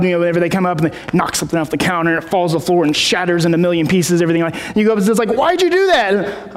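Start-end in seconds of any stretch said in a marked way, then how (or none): 5.3-6.6 high, drawn-out parental demand; imitated parent, pitched way up; exasperated parent. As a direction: scold a child in mock dismay. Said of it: "parent-child type of conversation".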